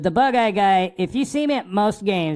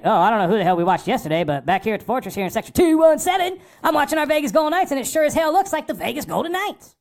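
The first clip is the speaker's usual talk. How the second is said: quick, pitched-up fan excitement, higher and fast; excited fan voice; 6 semitones higher than usual. Retold or imitated. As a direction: gush like a thrilled fan in the stands. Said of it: "immediately it was like".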